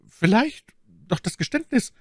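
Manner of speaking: high-pitched, apprehensive lady; alarmed exclamation. A narrator's reading. Milady pales awaiting the confession, alarmed. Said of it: "rief sie erblassend".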